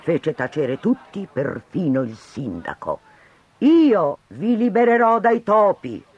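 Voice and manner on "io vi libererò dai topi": higher, loud, carrying over the crowd; clear ringing voice. Acted. Stranger silences the crowd, clear and loud. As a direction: cut through the noise. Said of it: "una voce chiara sovrastare tutto".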